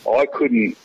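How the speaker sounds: normal talking voice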